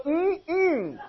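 high, drawn-out hum of agreement; grandma's approving hum. Retold or imitated. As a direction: hum like an old church grandma. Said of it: "she'd go".

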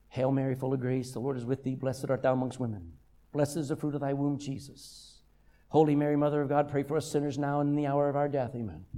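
low, monotone rote prayer; mindless recitation. Retold or imitated. Mourners repeating the rosary, imitated droning recitation. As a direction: rattle it off on one note, switched off. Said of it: "after they keep repeating. They're not thinking".

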